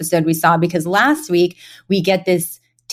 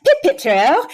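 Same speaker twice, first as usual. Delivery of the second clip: higher-pitched mock posh British chirp; upper-class British banter; 7 semitones higher than usual. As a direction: imitate a chipper upper-class British sister. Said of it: "reminded sort of how upper class he is".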